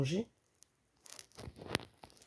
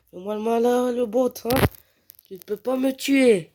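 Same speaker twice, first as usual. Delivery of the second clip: high, raised taunting voice; cocky taunting; 7 semitones higher, 5 dB louder than usual. Real, not acted. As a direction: goad an opponent who can't win. Taunting a bot. (provocation moqueuse, voix aiguë)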